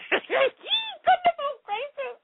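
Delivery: helpless real laughter, voice squeaking up high; laughing hard. Real, not acted. Host caught off guard by a listener's comment, laughing helplessly. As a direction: gasp out high-pitched words through uncontrollable laughter. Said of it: "I'm laughing so hard".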